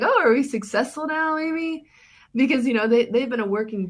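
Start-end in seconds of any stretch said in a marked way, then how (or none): 0.0-1.9 joking, high-pitched mock question; facetious. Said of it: "a facetious way".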